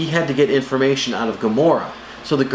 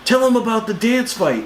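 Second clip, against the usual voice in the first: higher hammed-up Drax line; imitating Drax; 9 semitones higher than usual. Drax to the team, a higher mock Drax. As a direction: blurt it like a literal-minded brute. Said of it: "Drax says".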